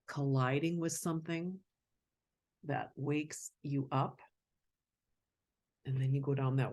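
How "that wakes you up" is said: ordinary delivery, nothing marked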